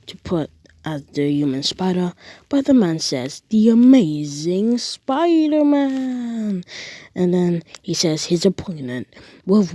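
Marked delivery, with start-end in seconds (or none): 3.5-6.6 slow, high, booming ring-announcer call. Acted. Ring announcer introducing the hero, drawn out and loud. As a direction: stretch it out like a wrestling announcer.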